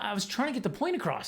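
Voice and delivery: normal talking voice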